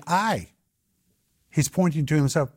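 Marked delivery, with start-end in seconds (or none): 0.1-0.5 "I" set apart, higher and falling; emphatic self-reference. Acted. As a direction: point to yourself with a strong "I". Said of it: "He's drawing attention to himself".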